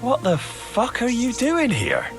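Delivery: high, drawn-out disbelief; incredulous outburst. Acted. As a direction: voice shoots up in indignant astonishment. Startled to find his betrayer.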